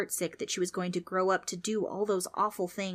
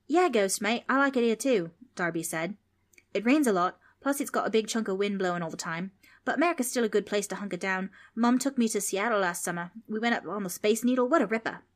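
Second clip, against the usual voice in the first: put-on Australian boy; 3 semitones higher, 4 dB louder than usual. Darby, Aussie boy.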